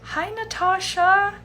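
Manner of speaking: ordinary talk, nothing marked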